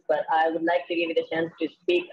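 ordinary delivery

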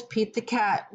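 usual speaking voice

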